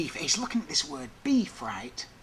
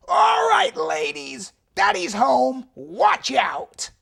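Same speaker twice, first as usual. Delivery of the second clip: loud, higher swaggering shout; tough guy bravado; 11 semitones higher, 11 dB louder, much slower than usual. Retold or imitated. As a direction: bellow it like you own the block. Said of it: "and he said".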